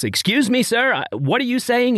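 high-pitched brash mock outburst; top of her lungs. American flight attendant challenging a passenger, mock top-of-lungs. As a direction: imitate a brash attendant calling out, no real shouting. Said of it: "top of her lungs".

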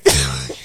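low cackle of amusement; real laugh. Real, not acted. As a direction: burst out laughing mid-story. Laughs at own story.

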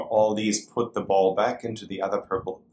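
ordinary talk

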